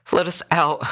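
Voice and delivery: high, tearful plea; crying. A narrator's reading. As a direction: beg through tears to be released. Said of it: "crying now".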